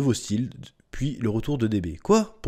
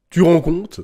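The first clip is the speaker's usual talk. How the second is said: loud, higher incredulous question; outraged disbelief; 6 semitones higher, 9 dB louder, slower than usual. Real, not acted. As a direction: throw it out louder, voice jumping up. Same question repeated, emphatically. (incrédulité forte, indignée)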